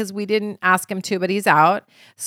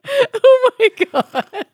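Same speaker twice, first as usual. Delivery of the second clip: laughing; 14 semitones higher, 7 dB louder, much slower than usual. Real, not acted. Laughing at dancing genie image.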